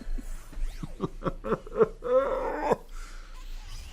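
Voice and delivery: high breathless crazy cackle; a maniacal villain laugh, voice giving out; unhinged villain laugh. Pretend. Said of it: "I'm too sick to do a crazy laugh, but I'll try".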